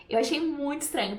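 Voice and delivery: usual speaking voice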